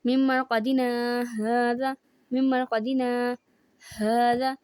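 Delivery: slow recitation with a stop after مرقدنا before هذا; drawn-out مرقدنا, then a held pause and هذا; slow Quran recitation. Shown as a demonstration. Teacher slowly recites the example from the lesson. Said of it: "سورۃ المطففین ہے".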